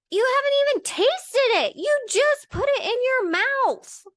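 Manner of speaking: high, loud indignant retort; bitter outburst. Retold or imitated. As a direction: let loose your bitterness at them, acted out. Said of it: "I was super bitter".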